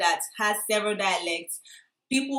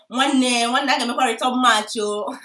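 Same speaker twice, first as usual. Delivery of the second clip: loud, higher-pitched street-tough demand; rowdy tout; 4 semitones higher, 8 dB louder than usual. Retold or imitated. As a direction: call out slang like a rowdy tout. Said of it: "they were saying things like".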